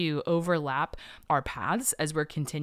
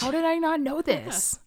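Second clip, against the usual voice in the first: high, amazed question; surprised; 11 semitones higher than usual. Real, not acted. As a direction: sound genuinely astonished. Surprised exclamation.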